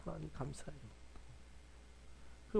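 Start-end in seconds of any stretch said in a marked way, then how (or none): 0.0-0.8 very soft murmured thanks, barely voiced; drowsy hushed prayer; lying-in-bed whisper. Shown as a demonstration. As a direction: breathe it out softly while still lying down. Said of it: "좀 누워가지고 막".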